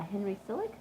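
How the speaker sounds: plain reading voice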